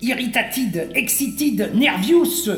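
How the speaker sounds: put-on English words in a lightly overdone French accent, "Irritatide", "nervious", "experatide"; silly French accent. Moon dweller showing off his English, ridiculous French accent. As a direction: list the English moods with a mock French accent. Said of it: "mon accent est un peu ridicule".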